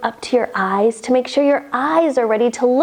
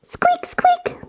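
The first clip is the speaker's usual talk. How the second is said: mouse squeaks; much slower than usual. Pretend. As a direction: squeak tiny like a teeny mouse. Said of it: "and say".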